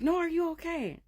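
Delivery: put-on high, loud kids' voice; insistent alarmed children. Daughters insist mother answer, high and alarmed. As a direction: press the question like a worried child.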